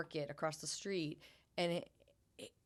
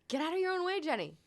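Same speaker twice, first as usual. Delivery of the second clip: much higher, louder mock scold; self-scolding pep talk; 12 semitones higher, 5 dB louder, faster than usual. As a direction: snap yourself out of it.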